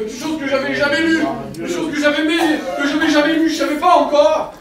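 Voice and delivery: high, loud, impassioned testimony, half laughing; fervent preaching. Real, not acted. Preacher telling the church of his healing, fervent and loud. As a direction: preach it out, carried away with joy.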